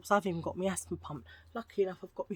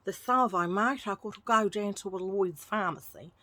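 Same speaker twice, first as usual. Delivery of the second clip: hammed-up Australian twang; bad Australian accent; 4 dB louder than usual. Vlogger joking about the pharmacy trip, bad Australian accent. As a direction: put on a terrible Australian accent. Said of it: "terrible Australian accent".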